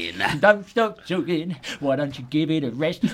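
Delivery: a lightly voiced, grumbling boss; mock gaffer. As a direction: chide him like an amused manager. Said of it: "But Gaffer loved it".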